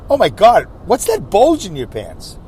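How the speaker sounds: loud, slow, gushing over-the-top exclaim; mock gushing. Mocking what women shouldn't say to him, loud slow gushing. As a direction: gush it out like a shocked admirer.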